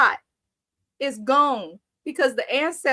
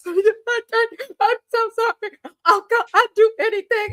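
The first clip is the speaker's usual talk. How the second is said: mock crying woman; 8 semitones higher, 4 dB louder than usual. Imitating the crying wife begging the uncle, high mock wail. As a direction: ham up a sobbing apology.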